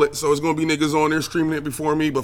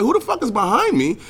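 alarmed outburst; 8 semitones higher than usual. Retold or imitated. Acting out getting shot from behind.

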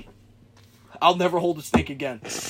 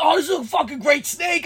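high-pitched mock enthusiasm; imitating a friend's giddy excitement; excited snake lover; 9 semitones higher than usual. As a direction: gush about your great pet snake. Mocks listener's old snake enthusiasm.

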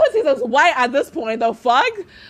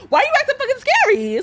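taunting; 11 semitones higher than usual. Retold or imitated. Taunting roommate at door.

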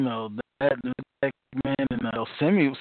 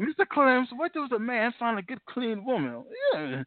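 quoting two characters; 9 semitones higher than usual. Retold or imitated. Quoting a movie dialogue.

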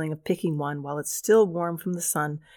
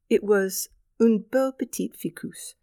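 slow, higher put-on French accent; un beau petit ficus in a hammed-up French accent; 5 semitones higher than usual. Shown as a demonstration. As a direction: savor it in a proud French accent. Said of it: "that is my French accent".

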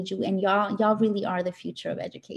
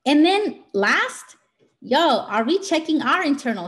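excited; 5 semitones higher, 6 dB louder than usual. Real, not acted. Emphatic turn to last point.

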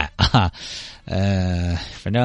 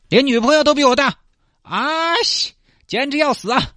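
frustrated outburst. Acted.

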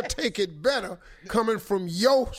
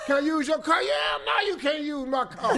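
high-pitched hollered exchange; 7 semitones higher than usual. Acted. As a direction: call it across the house. Acting loud crowded-house talk.

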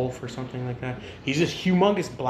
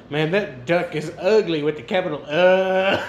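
mock disgusted insult; 3 semitones higher, 8 dB louder than usual. Duck insulting another duck to his friend, mock disgusted. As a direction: punch the insult, stretch the final sound. Acting out the duck's joke.

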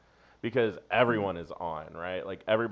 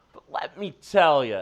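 comedian's stage bit voice, higher and louder; 6 semitones higher, 7 dB louder than usual. Shown as a demonstration. Comic slipping into stage mode with non-comic friends, bit voice. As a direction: launch into a bit, loud and high. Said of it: "drop into the bit voice".

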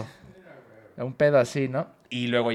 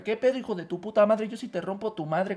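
higher mock Guadalajara brawl threat; mock tapatío threat; 8 semitones higher than usual. Acting out a Guadalajara street fight, mock threat. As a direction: pick a street fight, hammed up. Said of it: "aquí en Guadalajara".

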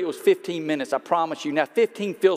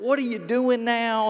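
light up at a familiar face; excited greeting; 7 semitones higher than usual. Retold or imitated. To the waiter, a former player recognized, excited greeting.

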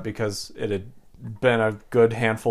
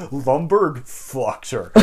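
slow, dragged-out frat bro line; much slower than usual. Retold or imitated. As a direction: drawl it like a dumb frat guy. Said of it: "Like the total frat bro".

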